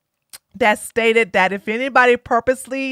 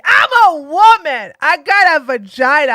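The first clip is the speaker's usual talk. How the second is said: higher, louder wail of mock outrage; indignant sore loser; 4 semitones higher, 7 dB louder than usual. Defeated candidate protests her humiliation, mock-indignant wail. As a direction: burst out in hammed-up indignation. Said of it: "She was like".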